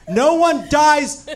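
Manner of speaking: high, loud, slow laughing outrage. Real, not acted. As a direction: hammer it home while laughing.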